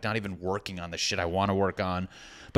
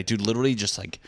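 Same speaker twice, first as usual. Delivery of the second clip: ordinary talk, nothing marked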